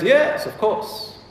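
high, drawn-out mock "yes"; mock confident assent. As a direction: play the impostor agreeing grandly. Acting false prophet's boastful assent.

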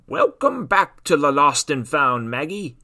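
greeting voice for Tony the sock; a hint of a friendly old sock; lightly voiced sock. Sock character speaks.

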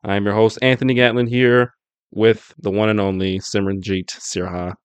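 ordinary talk, nothing marked

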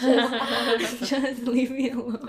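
laughing mock protest. Real, not acted. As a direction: protest while giggling.

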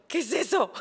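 high, wondering mock amazement; amazed question. Wife imitating husband discovering the bathroom, amazed. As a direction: imitate his childlike wonder.

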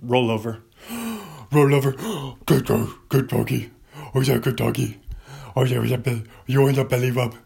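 loud gushing pet-talk voice; talking to a dog. Acted.

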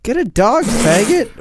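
usual speaking voice

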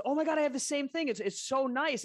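imitating her bubbly delight; gushing excitement. Coworker gushes that she owns the same wine opener. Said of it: "But she goes".

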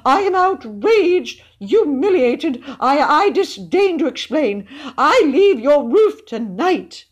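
very high, loud outrage; pompous, high-pitched indignant tirade; stammering fury. A narrator's reading. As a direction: rage with wounded dignity, words stretched. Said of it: "he stammered in fury".

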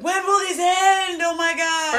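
high, slow, loud panicked wail; mock panic. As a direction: wail it like a freaked-out stoner. Stoner panic on edibles.

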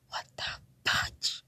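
slow, unvoiced stunned whisper; whispered, drawn-out bewilderment; hushed disbelief. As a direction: draw it out under your breath in disbelief. Reacting to a love declaration.

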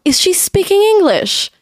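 high disbelieving question; confused, can't believe it; baffled. Retold or imitated. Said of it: "I was like".

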